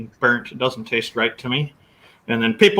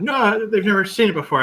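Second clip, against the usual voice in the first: higher-pitched imitation of a doubting wife; retold objection; 8 semitones higher than usual. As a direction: voice the wife's protest, pitched up.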